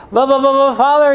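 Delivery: high, loud mock sputtering; flustered objecting parishioner. Mimics the parishioner's objection again.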